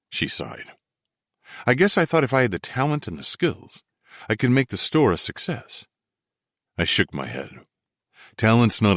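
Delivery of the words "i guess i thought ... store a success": lightly raised woman's voice; young woman voice. Acted. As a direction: voice her higher, eager. Felicity voiced by narrator.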